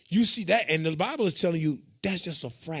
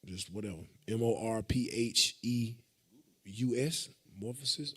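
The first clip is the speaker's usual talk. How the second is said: slow, soft letter-by-letter spelling; careful dictation, letter by letter; 6 semitones lower, 8 dB quieter, slower than usual. Shown as a demonstration. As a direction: sound out each letter slowly. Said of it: "I think it's A N T H R O".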